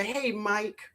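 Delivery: usual speaking voice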